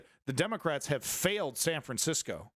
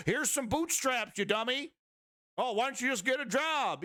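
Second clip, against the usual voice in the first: high-pitched mocking quote; scornful imitation in a raised pitch; mocking hardliner; 7 semitones higher than usual. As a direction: sneer it as a dismissive hardliner, pitched up. Mocks hardliners' answer to homeless.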